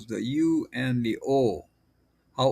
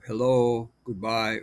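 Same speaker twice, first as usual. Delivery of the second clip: ordinary talk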